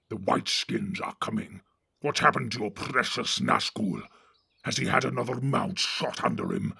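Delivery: gruff orc chief giving orders; Ugluk the orc captain. Acted. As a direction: bark orders at the cowardly Northerners. Ugluk mocks Grishnak.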